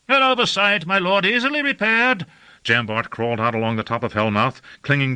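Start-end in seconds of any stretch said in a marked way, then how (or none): 0.1-2.3 high-pitched voice of a flustered underling; high, hurried-to-please excuse; apologetic servant. A narrator's reading. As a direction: placate your angry lord in a high voice. Servant excuses himself to angry lord.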